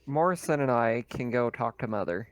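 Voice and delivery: normal talking voice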